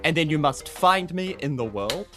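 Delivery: ordinary talk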